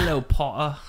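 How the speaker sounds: hammed-up British sneer; lightly voiced British villain; British accent. Host repeats the mock British accent. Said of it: "That's some kind of British".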